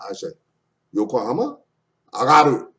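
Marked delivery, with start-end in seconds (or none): 0.9-1.6 "Yokohama" lifting at the end as a question; rising intonation. Shown as a demonstration. Teacher checking what was said, "Yokohama" with questioning rise. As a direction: turn "Yokohama" up on the last syllable.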